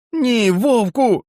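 high, slow cry; very high drawn-out shout; exclaiming. Acted. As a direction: cry out to stop the wolf. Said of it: "вигукнув Джек".

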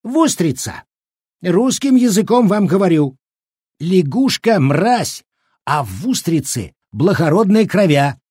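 high-pitched, exasperated explaining; indignant old man. A narrator's reading. Old cook setting the women straight, indignant. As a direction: insist with wounded dignity.

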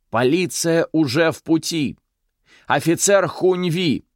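high, loud desperate shout. A narrator's reading. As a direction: yell it out in panic. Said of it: "она крикнула".

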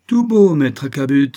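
loud, high commanding call; imperious. A narrator's reading. Captain ordering the suspicious merchant, loud and imperious. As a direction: command him like an officer. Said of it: "d'une voix impérieuse".

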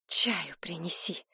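shaken, voiceless command; numb whispered order. A narrator's reading. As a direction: whisper the order, lips barely obeying. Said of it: "приказала она непослушными губами". (шёпотом, непослушными губами)

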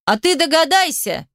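high, goading retort; mocking challenge through a door; taunting. A narrator's reading. Taunting him through locked door.

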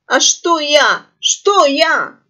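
high, loud squawky grumble; grumbling parrot. A narrator's reading. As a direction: grumble it back defensively in a squawk. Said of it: "буркнула дурачок".

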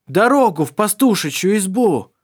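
loud high shout; shouting over wind. Acted. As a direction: shout the demand over the noise. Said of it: "повторил Доминик".

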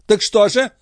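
high, impatient question; impatient demand. A narrator's reading. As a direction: press the servant for an answer. Impatient queen questions the valet. (нетерпеливо, высоким голосом)

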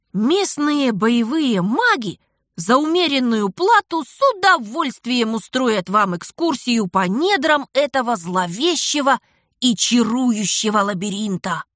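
plain reading voice

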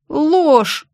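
high, loud shout of protest; yelled denial. Acted. Heroine yells at her boss, loud high shout. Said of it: "заорала я".

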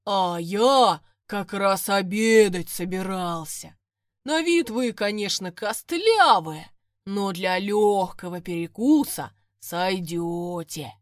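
slow, loud gurgling swamp monster; gurgling fish man croak. Acted. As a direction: savour every word like a hungry bog beast. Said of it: "от его булькающего голоса по воде пошли круги". (булькающий рокот водяного)

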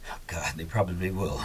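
breathless, voiceless whisper of dismay; a hushed, defeated whisper; whispered aside. Acted. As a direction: mutter it to yourself under your breath, beaten. Dismayed aside after bad news.

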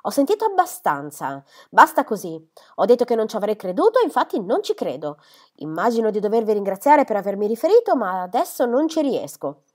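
rabbia trattenuta a stento, più forte; furioso. A narrator's reading. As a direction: taglia corto, pieno di sdegno. Padre furioso per le calunnie. (padre che respinge le voci sulla figlia, irato)

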